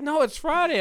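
high, loud mock correction; imitated coworker. Retelling coworker correcting the day, high and emphatic. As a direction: pitch it up, cartoonishly insistent. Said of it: "she's like".

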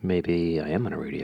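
low, soft radio voice. Acted. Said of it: "a voice for radio".

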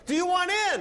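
high, loud local man's offer. Retold or imitated. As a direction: call out an eager offer, pitched high. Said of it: "and the man goes".

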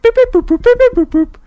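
high, loud electronic beeping; imitated phone beeps. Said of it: "the phone literally went".

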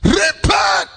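repent shouted high and loud. Real, not acted. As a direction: call out with full force. Preacher shouting.

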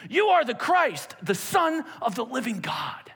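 bold proclamation. Retold or imitated. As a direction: proclaim it with full conviction. Peter's confident declaration.

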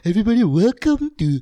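high-pitched mock presenter welcome; Ezra Syed. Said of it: "that's Ezra Syed".

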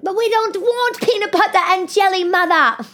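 put-on very high, whiny child voice; mock children protesting to their mother; complaining kids. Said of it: "and they're like".

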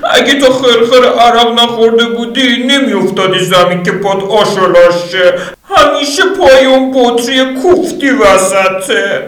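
high female voice nagging; Lydia, scolding. Acted. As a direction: blame him for the bottle.

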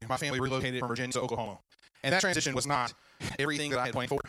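ordinary talk

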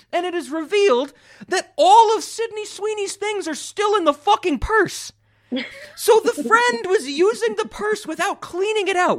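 very high, loud indignant squawk; incredulous rant. Real, not acted. Critic exposing the purse plot hole to co-host, incredulous. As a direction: pitch soaring in outraged disbelief.

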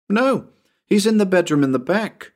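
put-on high boy's correction; protesting child. Tommy explains which bedroom, high insistent boy voice.

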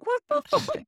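mock shock. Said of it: "And you're like".

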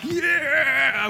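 high, loud whooping yell; boys' excited cheer. Retold or imitated. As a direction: cheer like pumped-up kids. Boys cheering during countdown.

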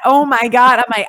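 ordinary talk

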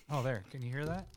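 ordinary talk, nothing marked

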